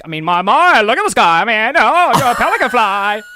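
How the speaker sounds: high, loud hammed-up Cockney rap; mock Cockney accent. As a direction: rap it in a cartoonish fake Cockney. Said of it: "when she talks in her weird Cockney accents".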